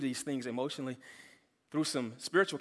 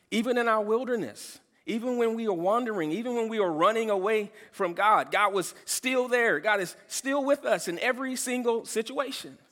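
higher, louder preaching build; rising preaching; 8 semitones higher, 5 dB louder than usual. Acted. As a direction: lift the congregation, building up.

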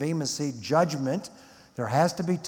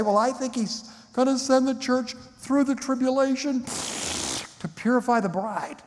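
much higher put-on voice for the other view; quoting others; 10 semitones higher than usual. Preacher quotes people who expect the church in tribulation, mock voice. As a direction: voice the opponents' claim in a lifted mock tone. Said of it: "I've heard a few people say".

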